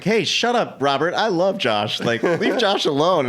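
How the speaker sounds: imitating Sandra Bullock sticking up for him. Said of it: "Sandra Bullock was like".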